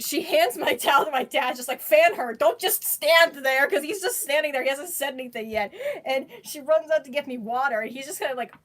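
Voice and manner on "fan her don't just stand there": imitating mom's loud, urgent order; mock mom snapping at dad; bossy mom. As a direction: bark the order at dad, slowly. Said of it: "my mom freaks out".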